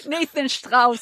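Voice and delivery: put-on laughing German accent, slow. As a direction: Strauss with a German sh-trauss. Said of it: "I gotta do the German accent".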